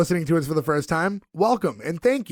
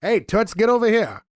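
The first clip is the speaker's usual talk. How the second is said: put-on old-timey greaser; 5 semitones higher than usual. Imitates old-timey sleazeball.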